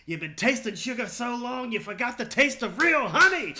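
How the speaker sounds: loud, high Pacino growl; Al Pacino impression. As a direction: belt it like Pacino in Scent of a Woman. Quoting Al Pacino movie line.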